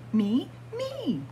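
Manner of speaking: ordinary delivery, nothing marked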